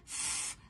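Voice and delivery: "ف-" as pure voiceless breath, no vowel; blown f sound. Shown as a demonstration. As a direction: blow a long, soft fff for "ف-". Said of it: "انفخ بقى كده". ("ف-" نفخ من غير حركة)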